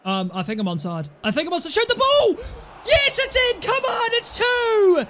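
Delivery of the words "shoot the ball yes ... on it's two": high, loud yelling; real excitement; goal celebration. Real, not acted. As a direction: scream with joy at the goal.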